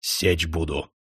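low, menacing fury held down; dark, quiet rage; enraged threat. A narrator's reading. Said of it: "рассвирепел князь".

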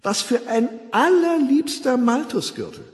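high, gushing admiration; begeistert. A narrator's reading. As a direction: coo in delight over a friend's accessory. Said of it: "rief Fanny begeistert". (hell entzückt, schwärmerisch)

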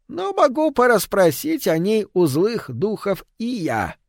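put-on high, toothless old hag; old witch voice. A narrator's reading. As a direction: croak it like a hungry old witch.

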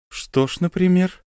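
rising, puzzled question; surprised question. Acted. Officer asks the count, rising in surprise. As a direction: act genuinely surprised. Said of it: "удивлённо спросил".

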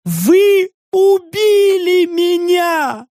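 высокий медленный надрывный крик; кричит как раненая птица; истеричный выкрик. Acted. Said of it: "Выкрик Нанны был похож на крик птицы". (пронзительный истеричный выкрик)

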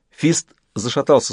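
plain reading voice

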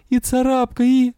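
high, anguished voice breaking off; moaning plea. A narrator's reading. Patient pleading with the witch for the others, high moan. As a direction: keep the pained moan high, then leave it unfinished. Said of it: "простонала я".

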